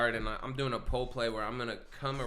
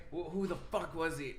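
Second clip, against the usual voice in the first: usual speaking voice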